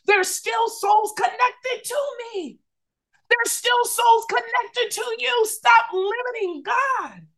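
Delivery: fervent pulpit exhortation; preaching climax. Acted. Preaching peak, high and slow.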